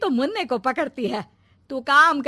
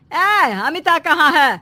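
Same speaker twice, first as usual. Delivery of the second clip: louder, higher, slow call; 5 semitones higher, 5 dB louder, slower than usual. Acted. Mother-in-law calls out to her son, loud. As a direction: shout the question across the house.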